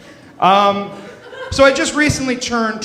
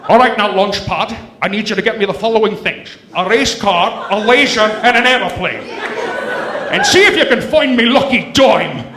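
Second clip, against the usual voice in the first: Sean Connery as Scrooge; 2 semitones higher than usual. Pretend. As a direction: slur it like Connery playing Scrooge. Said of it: "Sean Connery as Uncle Scrooge".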